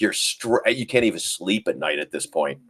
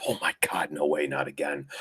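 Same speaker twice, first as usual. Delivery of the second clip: hushed, breathy dread; mock panicked whisper; 6 semitones lower, 10 dB quieter than usual. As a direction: whisper it like a trader watching losses. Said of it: "they were like".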